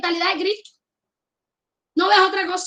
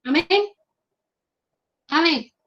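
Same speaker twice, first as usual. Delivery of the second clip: usual speaking voice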